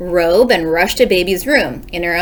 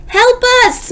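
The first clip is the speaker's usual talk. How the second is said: high, loud cry for help; calling for help; 5 dB louder than usual. Acted. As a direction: call out from far inside the house. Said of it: "Missus Watson called".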